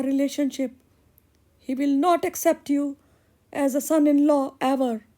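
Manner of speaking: put-on high girlish voice, sweet then worried; young girl in love. Daughter tells the blacksmith her father won't approve, in a high girlish voice. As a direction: play the bright young daughter fretting about her father.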